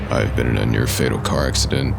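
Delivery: usual speaking voice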